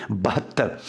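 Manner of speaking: ordinary talk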